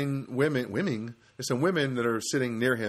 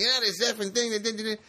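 high-pitched mock rant; retold frustration; 10 semitones higher than usual. Frustrated caller retelling his swearing at the bank line, mock-annoyed. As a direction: imitate venting, not yelling. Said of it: "I got really frustrated".

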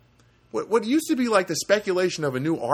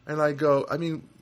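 usual speaking voice

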